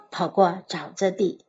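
ordinary delivery, nothing marked

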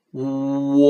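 "world" stretched through oo, r, l and final d; slow reading. Shown as a demonstration. Teacher linking the letters of world, slow drawn-out demonstration. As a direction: blend every sound of "world" very slowly. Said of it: "慢慢的读".

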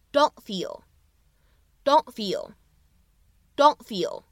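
"don't feel" with the t unreleased; very slowly say "don't feel", t held back; 不完全爆破 don't feel; incomplete plosion. Shown as a demonstration. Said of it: "不完全爆破的处理".

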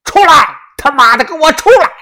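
high, loud enraged roar; furious shouting. A narrator's reading. Old master dares the Song brothers out, furious shouting. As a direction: challenge hidden enemies at full voice. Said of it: "怒声地喝道".

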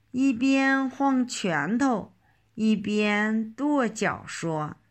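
ordinary delivery

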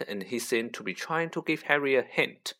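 ordinary delivery, nothing marked

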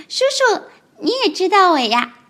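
高高的童声，天真认真; 小孩一本正经地解释; 天真的小恐龙. Acted. 小恐龙角色对白. (天真的高音童声)

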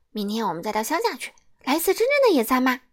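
excited rapid chatter; fast eager plan. Acted. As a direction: rush through tomorrow's plan. Mr. Busy plans eagerly.

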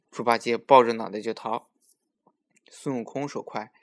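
ordinary delivery, nothing marked

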